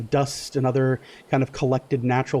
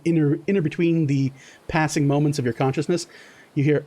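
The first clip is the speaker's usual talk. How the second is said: ordinary delivery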